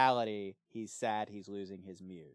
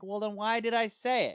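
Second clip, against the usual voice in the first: higher and louder, exasperated; exasperated retort; 11 semitones higher, 5 dB louder than usual. Retold or imitated. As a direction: snap back, fed up with being doubted. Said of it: "it's like".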